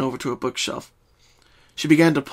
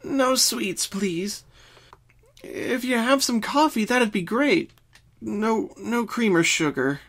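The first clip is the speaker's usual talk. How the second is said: higher, weary and groaning; soft groan; 9 semitones higher, slower than usual. Acted. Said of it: "Pinkie softly groaned".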